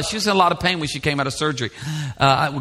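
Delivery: ordinary talk, nothing marked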